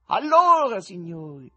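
high, loud scornful tenor. Acted. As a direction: sneer at the presenter.

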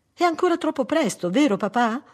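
put-on very high, loud child's voice; little girl. Daughter checking with her father, high childlike questioning. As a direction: ask daddy like a small daughter.

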